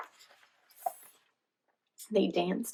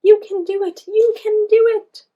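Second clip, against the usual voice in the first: high, loud chant; excited chant, much higher and louder; cheering; 13 semitones higher, 12 dB louder than usual. A narrator's reading. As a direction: cheer a friend on. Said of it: "They all cheered for Sasha".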